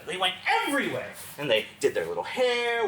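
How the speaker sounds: ordinary delivery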